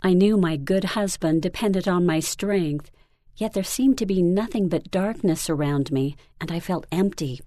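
ordinary delivery, nothing marked

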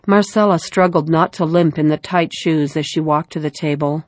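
ordinary delivery, nothing marked

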